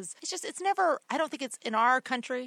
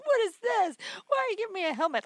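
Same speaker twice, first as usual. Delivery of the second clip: put-on much higher, indignant six-year-old; little girl upset; 10 semitones higher, faster than usual. Remembering being six and given a helmet, high indignant child. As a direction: squeak it like a baffled kid. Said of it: "I'm like".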